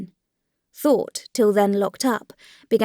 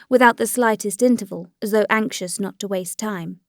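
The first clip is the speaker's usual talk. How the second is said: usual speaking voice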